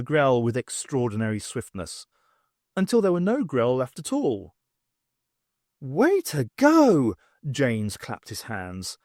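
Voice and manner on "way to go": high, slow cheer; mock cheer from the professor. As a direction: celebrate the win loudly. Janes cheers.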